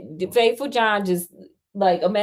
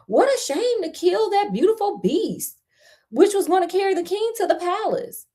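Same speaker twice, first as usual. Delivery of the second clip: high-pitched outcry from grumbling servants; high, indignant servant outcry; crying out; 11 semitones higher than usual. A narrator's reading. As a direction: cry it out in protest, pitched well up. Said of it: "who bore faithful John no love cried out".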